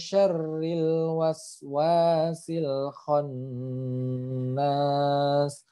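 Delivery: slow chanted khannas with nun held; held recitation. Shown as a demonstration. Teacher chanting the verse for students. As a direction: recite it slowly, holding the nun. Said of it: "Jadi ditahan seperti itu".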